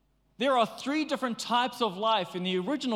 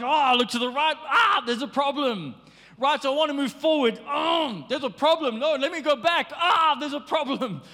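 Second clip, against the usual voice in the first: mock panic; 6 semitones higher, 5 dB louder than usual. Acting out being surrounded.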